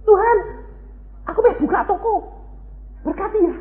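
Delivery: high, laughing put-on prayer voice; mock shopkeeper prayer. Believer asks God to bless his shop, high and mocking. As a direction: parody a greedy blessing request.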